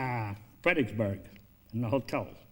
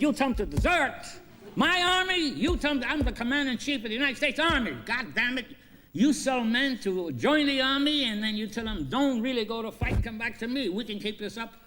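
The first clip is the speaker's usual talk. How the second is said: angry outburst; 9 semitones higher than usual. Retold or imitated. Lincoln scolding the recruiter, high and furious. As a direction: chew him out as the commander-in-chief.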